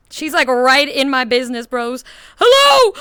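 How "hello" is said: high, loud hello; excited shouted hello. Real, not acted. Streamer shouting hello to her friend in VR. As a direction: yell hello in delight. Greeting friend's avatar up close.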